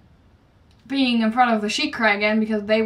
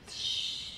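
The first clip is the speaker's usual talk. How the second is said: soft whispered shush to keep a secret; a quiet breathy shh; playful shush; 14 dB quieter than usual. Real, not acted. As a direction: hush them conspiratorially. Hushing about a secret.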